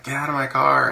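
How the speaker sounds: lightly acted order to get out; half-hearted imitation of an annoyed owner; mock dog owner. Dog owner yelling at his dog.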